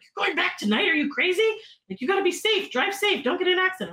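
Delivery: high-pitched, fussing disbelief; imitating someone alarmed for a fan; incredulous concern. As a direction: scold them with worried amazement. Band worried about her late drive.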